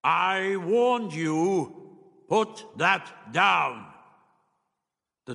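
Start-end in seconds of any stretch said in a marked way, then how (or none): 0.0-3.9 very slow, high, booming warning. A narrator's reading. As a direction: boom each word out long and drawn. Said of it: "The voice boomed again".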